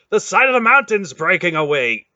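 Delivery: high, loud warning; alarmed shout. Acted. Relg shouting that the mountain is collapsing, loud. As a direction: cry it out in alarm.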